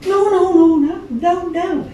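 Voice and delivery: high, loud emphatic no. Retold or imitated. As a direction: wave off the offer. [name] refuses juice.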